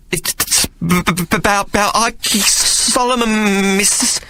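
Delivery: high, slow stammering urchin. Acted. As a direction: stutter it out like a scared boy. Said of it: "when he stammered".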